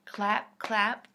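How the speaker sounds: loud punchy claps; clap sound effect. Acted. Reader acting out clapping for children. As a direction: pop each clap out loud.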